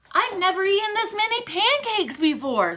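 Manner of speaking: put-on high little-girl voice. A narrator's reading. Girl at breakfast raves about pancakes, high girl voice. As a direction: voice an eager small girl. Girl's voice in story.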